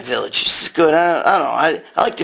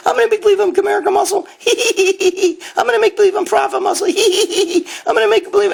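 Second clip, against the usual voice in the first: much higher, fast, cackling mock voice of a childish troll; faster than usual. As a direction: squeal it out laughing, mocking the kids with fake accounts. Mocking fake-account trolls.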